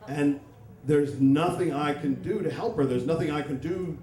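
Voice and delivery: ordinary talk, nothing marked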